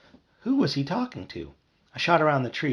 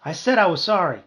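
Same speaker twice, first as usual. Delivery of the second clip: high, loud whine; raised, pleading whine; whining; 6 semitones higher, 5 dB louder than usual. A narrator's reading. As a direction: whine out a defensive apology. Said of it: "Barry whined".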